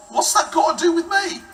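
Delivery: put-on high, loud, indignant teenage retort; teenage backchat. Jesus answering his mum like a teenager, indignant. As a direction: fire it back like a stroppy teen. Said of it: "Like any teenager".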